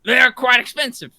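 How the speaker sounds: high, loud put-on voice; loudly haughty about handkerchiefs; indignant character voice. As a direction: raise it high and loud, playing affronted. Arguing in character over handkerchiefs.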